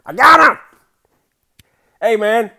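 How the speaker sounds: very high, loud victory yell; triumphant scream. Real, not acted. Wins after many games.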